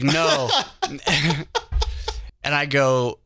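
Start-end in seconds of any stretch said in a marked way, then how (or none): 0.0-0.5 laughing. Retold or imitated. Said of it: "I'm like". 0.5-2.4 high giggle mid-story; real laughter at the memory; laughing. Real, not acted.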